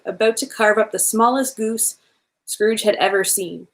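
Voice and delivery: usual speaking voice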